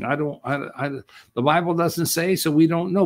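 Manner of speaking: ordinary talk, nothing marked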